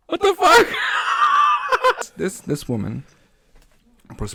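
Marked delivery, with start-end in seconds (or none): 0.1-0.7 high, loud laughing outburst; real laughing disbelief, much higher and louder; laughing shout. Real, not acted. 0.7-2.1 high, loud shrieking laugh; a real high-pitched laughing shriek. Real, not acted. Laughing burst.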